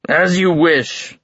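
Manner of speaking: a hint of a giant king; lightly voiced giant king. A narrator's reading. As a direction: stretch it out with lordly ease. Giant king grants the demand.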